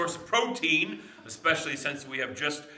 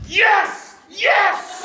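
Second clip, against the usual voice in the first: high, loud joyful yes; excited scream; 10 dB louder than usual. Acted. Dad spotting the gas can, excited yells. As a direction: shout with relief at the gas can. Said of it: "He screams with excitement".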